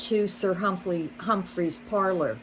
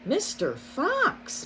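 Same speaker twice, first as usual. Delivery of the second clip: slow, much higher plea; protesting; 10 semitones higher, slower than usual. A narrator's reading. Rabbit protesting as the fox shakes the mole, slow and high. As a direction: stretch out his name in protest. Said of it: "Missus Rabbit protested".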